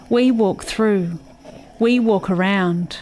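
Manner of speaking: ordinary talk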